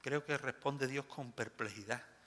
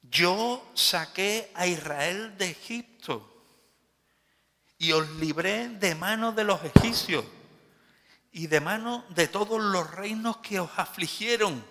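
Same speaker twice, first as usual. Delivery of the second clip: God's reproach; 7 semitones higher, 11 dB louder than usual. Acted. God reminding Israel of Egypt, loud solemn reproach. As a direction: proclaim God's deeds with booming force. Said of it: "Así ha dicho Jehová".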